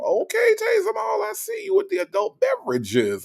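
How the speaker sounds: very high, excited I-see-you shout; teasing callout. Real, not acted. Teasing a viewer who is sipping rum, in a very high excited shout.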